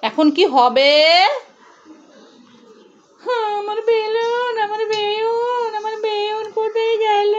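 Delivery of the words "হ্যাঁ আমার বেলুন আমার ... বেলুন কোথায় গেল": slow, softer pretend wail; hammed-up tears for a lost balloon; mock crying. Mother as her son, mock crying over the lost balloon.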